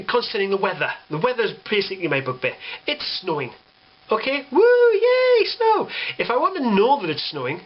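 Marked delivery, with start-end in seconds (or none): 4.1-5.9 squealing high mock joy over snow; shrill mocking cheer; mock excitement. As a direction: parody a giddy poster shrieking about snow.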